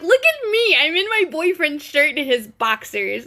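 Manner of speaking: mock-squealing girlfriend bragging; giddy show-off. She shows off boyfriend's shirt.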